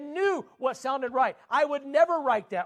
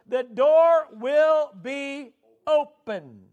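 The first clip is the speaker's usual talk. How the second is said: slow, emphatic, each phrase left to land; slow scripture quote; 4 semitones higher, 5 dB louder, much slower than usual. Acted. Preacher reading Jesus' promise to the congregation, slow and emphatic. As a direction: lean on "one", drawn out and high.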